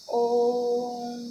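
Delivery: ओम chanted long, with a held vowel; sustained om; drawn-out om. Shown as a demonstration. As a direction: draw out ओम like a chant. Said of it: "बोल के देखिएगा".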